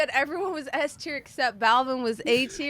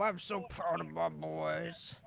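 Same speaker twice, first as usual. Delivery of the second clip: soft doting praise; 4 semitones lower, 14 dB quieter, slower than usual. Acted.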